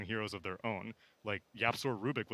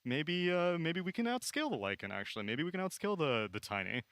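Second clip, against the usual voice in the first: higher, hesitant second-guessing voice; a hint of a dithering drafter, pitched up; mock indecisive drafter; 7 semitones higher than usual. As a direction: waver like a team changing its mind mid-draft. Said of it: "you can't be like".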